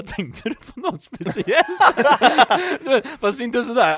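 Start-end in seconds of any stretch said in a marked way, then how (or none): 0.0-1.7 high, slow, laughing through the question; can't keep a straight face while asking; laughing challenge. Retold or imitated. Retelling a stare-down with a stranger, laughing. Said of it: "jag bara". 1.7-2.7 a burst of high loud laughter. Real, not acted. Friends in a podcast hear the story, loud laugh.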